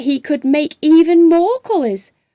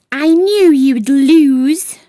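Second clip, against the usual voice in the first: plain reading voice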